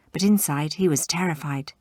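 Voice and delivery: usual speaking voice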